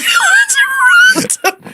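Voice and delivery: loud, high shrieking laugh; helpless laughter. Real, not acted. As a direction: burst out laughing at your own silliness. Cracks up at own silly name.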